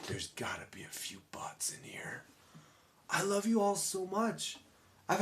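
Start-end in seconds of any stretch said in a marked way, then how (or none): 0.1-2.2 whispered aside. Real, not acted. Joking aside to audience.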